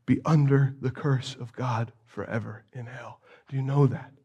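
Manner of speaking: preacher quietly pressing the congregation; solemn appeal. Real, not acted. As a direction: draw them in, grave and quiet.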